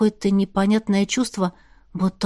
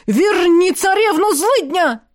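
yelling; 8 semitones higher, 5 dB louder, slower than usual. Acted. Hero yells while hacking reeds.

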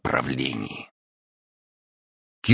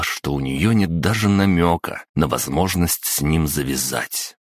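plain reading voice

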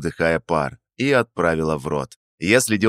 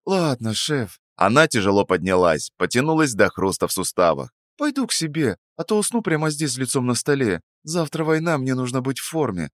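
tired and full; sleepy young woman; 6 semitones higher than usual. Acted. Narrator voices sleepy Sveta.

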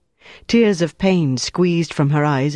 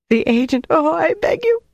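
very high, anguished woman's voice; desperate begging; 13 semitones higher than usual. A narrator's reading. Prisoner begging her interrogator to let her go, desperate begging. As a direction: beg in a high wail.

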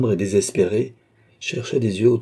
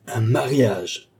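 slow, drawn-out scornful echo; mounting indignation; 3 semitones higher, much slower than usual. A narrator's reading. Young woman throwing back the marriage offer, drawn-out indignation. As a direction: stretch the word in outrage. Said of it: "s'exaltant de plus en plus".

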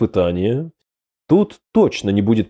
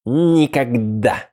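spiteful; much slower than usual. Acted. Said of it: "злобно сказал сундук".

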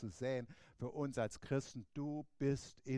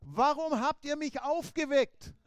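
higher, slow, mildly exasperated question; a touch of Jesus put out at being woken; annoyed Jesus; 11 semitones higher, 10 dB louder, slower than usual. Retold or imitated. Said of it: "Jesus hat eigentlich gesagt".

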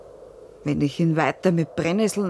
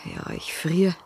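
ordinary talk

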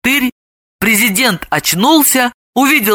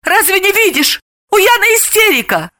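high, exasperated retort; indignant high-voiced protest; 12 semitones higher than usual. A narrator's reading. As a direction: scold him with pitch shooting up. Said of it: "возмутилась Снегурочка".

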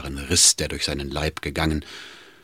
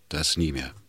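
very quiet; 2 semitones lower, 7 dB quieter than usual. Acted. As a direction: let it drop softly. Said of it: "sagte er sehr leise".

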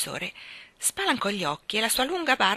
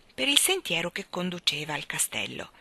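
ordinary delivery

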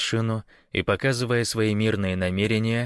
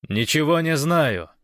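raised, higher-pitched retort; loud denial, falling at the end; defiant shout; 7 semitones higher, 5 dB louder than usual. A narrator's reading. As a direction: shout back at the guards. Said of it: "крикнул гость".

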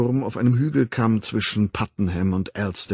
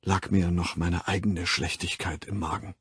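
lower, softer muted confession; 4 semitones lower, 6 dB quieter than usual. A narrator's reading. John quietly confessing his own wickedness, muted. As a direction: drop the voice as if ashamed. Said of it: "in gedämpftem Ton".

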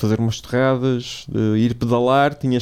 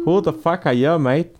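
put-on Australian accent, slightly higher; 4 semitones higher than usual. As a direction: ask it like an Aussie bloke. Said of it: "o sotaque australiano".

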